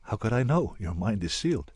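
usual speaking voice

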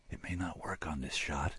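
hushed whisper to herself; whispered reminder. Acted. Woman reminding herself in a whisper. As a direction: whisper it under the breath.

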